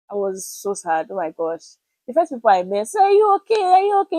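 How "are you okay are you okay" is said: high, bright imitation of worried friends asking; mock concerned friends. Friends at church greeting her, imitated in a high concerned voice. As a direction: chirp the question up high like a sweet worried friend.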